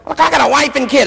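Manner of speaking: high-pitched protest from a lightly acted cop; pleading cop. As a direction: plead it high, as a harried family man. Acting out the other officer pleading.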